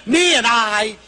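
indignant, emphatic lecture; exasperated scolding. Retold or imitated. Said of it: "I said".

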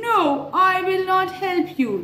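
high cat voice saying no; refusing cat. Acted. Cat refusing to help the hen, lightly voiced. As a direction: turn her down as the cat.

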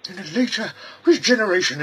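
a dithering little man catching on; high-pitched bumbling stranger; high squeaky visitor. Acted. Visitor realizes who it is.

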